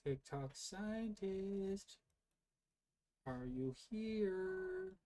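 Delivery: high, slow sing-song call; playful summoning. Pretend. Calling TikTok scientist into the live, slow and high.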